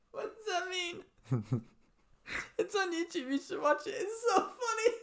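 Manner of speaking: very high, squeaky, real laughing fit; helpless laughter. Real, not acted. Friend recommending the video to co-host, in helpless laughter. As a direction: wheeze the words out through tears of laughter.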